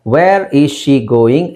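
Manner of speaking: slow, deliberate teaching delivery; emphatic model question. Shown as a demonstration. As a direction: spell out the question word by word, slowly. (ನಿಧಾನವಾಗಿ, ಪದಪದವಾಗಿ ಒತ್ತಿ ಹೇಳುವುದು)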